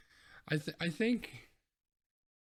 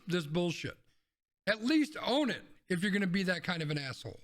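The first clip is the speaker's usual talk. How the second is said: angry rant; 5 semitones higher than usual. Real, not acted. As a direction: let rip at them, pitched up and emphatic. Indignant, swearing rant at lawmakers.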